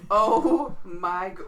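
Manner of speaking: loud, drawn-out "Oh" leading to a cut-off "g-"; God with an O. Retold or imitated. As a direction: stretch "Oh" loud and slow, break off at "g-". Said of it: "force myself to say it with an O".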